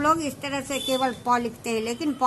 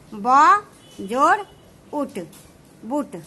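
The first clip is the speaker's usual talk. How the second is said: ब जोड़ उठ said slowly, part by part; धीरे धीरे अक्षर जोड़ते हुए; slow spelling out; slower than usual. Shown as a demonstration. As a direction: spell out ब, जोड़, उठ one piece at a time for students. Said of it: "इसको लिखने का तरीका क्या हो गया".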